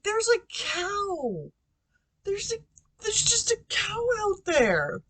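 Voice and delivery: high, drawn-out frantic cry. Retold or imitated. Telling mom about the cow, high frantic cry. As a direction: blurt it out as if nobody believes you.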